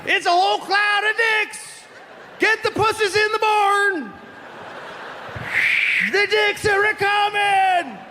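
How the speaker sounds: high, hollering yokel warning; hillbilly farmer shout. Acted. Farmer calling alarm.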